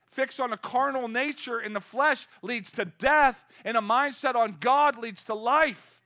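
high, loud preaching to the congregation; full-force preaching. Acted. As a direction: drive each line home from the pulpit.

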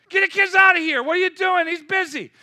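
put-on loud, high yelling to shoo the kids off; angry disciples. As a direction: bark at the parents to get out. Disciples shooing kids again.

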